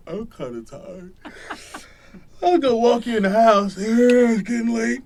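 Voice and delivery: ordinary talk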